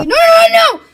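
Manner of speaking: very high, loud protest; panicked shouting. Acted. Trapped Mario hearing the temperature change, panicked shouting. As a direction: cry out in alarm.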